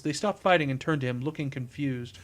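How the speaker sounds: plain reading voice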